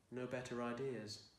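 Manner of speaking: ordinary delivery, nothing marked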